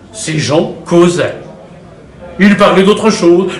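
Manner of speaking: ordinary delivery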